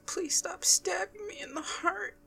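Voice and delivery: high, soft, pained plea; mock pleading. Fan begs a sad storyline for mercy, mock pleading. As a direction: plead softly up high, as if wounded.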